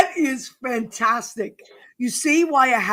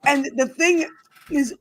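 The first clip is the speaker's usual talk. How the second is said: normal talking voice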